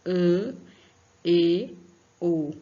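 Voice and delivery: ã, e, o said loud, one by one, as example sounds; vowel sound demo. Shown as a demonstration. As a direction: model ã, e, o for the pupils. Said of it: "O som aberto fica". (vogais bem marcadas)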